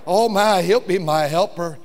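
ordinary delivery

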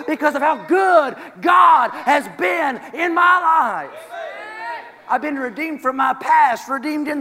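loud, high, shouting preacher; full-force preaching. Acted. Preacher shouting praise to the congregation. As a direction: preach it out with fire to the church.

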